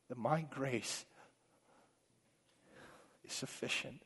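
hushed tender call; voice of God. Acted. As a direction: speak gently as a loving father to his child. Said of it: "And he says to us".

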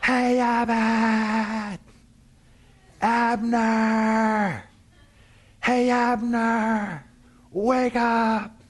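high, very drawn-out comic holler; Costello. Acted. David calling the sleeping Abner like a movie comic, hollering. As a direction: bellow the name long and loud, like an old slapstick movie. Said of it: "Costello would yell out".

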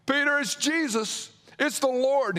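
John telling Peter it's the Lord, excited; excited recognition. Acted. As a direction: announce the discovery with rising excitement.

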